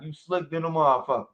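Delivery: imitating Zoo's deep voice. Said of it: "With his deep ass voice".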